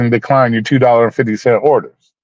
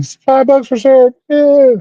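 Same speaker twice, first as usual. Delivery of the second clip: excited; 13 semitones higher, 3 dB louder, slower than usual. Real, not acted. Excited reaction to a five-dollar tip.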